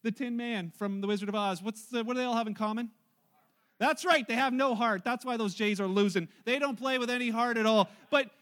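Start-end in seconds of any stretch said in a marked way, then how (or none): 3.8-8.3 loud punchline. Real, not acted. Pastor delivering the no-heart punchline to the congregation, loud. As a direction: land the joke big.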